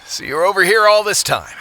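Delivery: ordinary delivery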